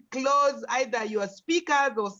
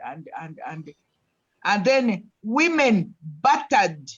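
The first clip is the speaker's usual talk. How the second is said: ordinary delivery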